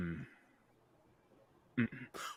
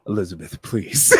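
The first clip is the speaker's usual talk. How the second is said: pleading; 7 semitones lower, 3 dB louder than usual. Acted. Valar pleading with Elizabeth.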